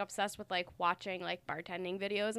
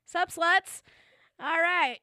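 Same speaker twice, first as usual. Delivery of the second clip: loud greeting; 8 semitones higher, 6 dB louder, slower than usual. Real, not acted. Show opening greeting.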